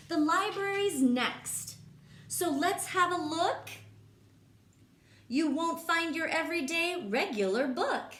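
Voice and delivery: lively, sing-song read-aloud voice; reading to children. Acted. Teacher reading a rhyming book to kids, lively. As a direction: bounce the rhymes for the class.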